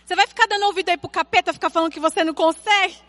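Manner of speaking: high retold telling-off, long called name then rapid rush; imitating a fired-up little girl's reprimand; child scolding friend. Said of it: "Aí eu já virei pra ela e falei assim".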